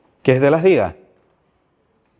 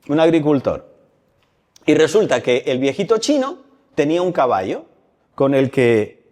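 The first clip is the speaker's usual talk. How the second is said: ordinary talk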